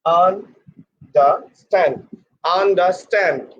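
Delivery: UNDERSTAND very slowly, an, da, stand; no R sound. Shown as a demonstration. Teacher pointing out there is no R, slowly said an-da-stand. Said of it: "There is no R".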